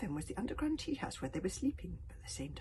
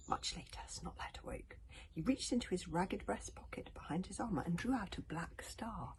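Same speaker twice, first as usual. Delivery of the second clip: whispered story reading; 3 semitones lower than usual. A narrator's reading. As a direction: read it in a hushed whisper to sleepy children. Whispered bedtime story narration.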